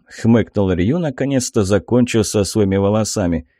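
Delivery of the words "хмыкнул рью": plain reading voice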